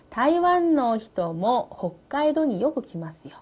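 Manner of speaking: very slow reading. Shown as a demonstration. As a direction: read it out very slowly. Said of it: "teacher reads model line".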